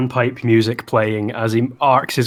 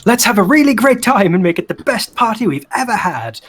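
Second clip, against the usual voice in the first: yelling; 10 semitones higher, 4 dB louder, faster than usual. Acted. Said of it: "he's yelling at everyone".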